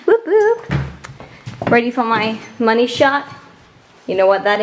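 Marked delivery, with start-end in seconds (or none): 0.1-0.7 higher, rising playful whoops; giggly whoop whoop. Real, not acted.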